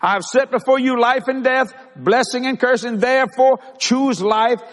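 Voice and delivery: high-pitched, emphatic pulpit voice with drawn-out key phrases; fervent preaching. Acted. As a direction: preach it hard to the congregation. Proclaims the verse again.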